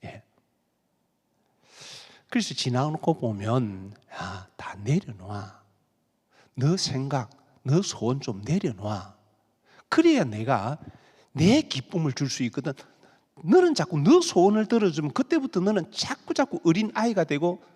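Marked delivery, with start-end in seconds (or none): 4.2-12.7 God gently telling you to let go; gentle God's voice. Retold or imitated. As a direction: soothe the child like a patient father.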